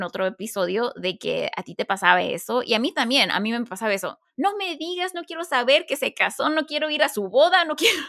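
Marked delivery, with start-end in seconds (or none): 4.4-8.0 put-on higher, half-laughing childish whine; mock whiny protest. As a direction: play up a sulky no-I-don't-want-to-know, laughing at yourself. Imitating her own past jealousy.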